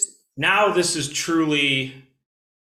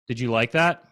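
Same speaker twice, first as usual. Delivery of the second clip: ordinary talk, nothing marked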